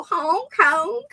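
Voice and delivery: high-pitched imitated honks; two high honks, rising at the end; clown honk. Shown as a demonstration. Streamer kinda doing Clown Drew's honk, imitated honking. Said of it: "I can kinda do it".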